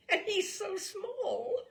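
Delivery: high, slow, laughing coo; cooing woman. Acted. Fragrant woman cooing at the small boy, high and slow. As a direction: melt over how tiny he is.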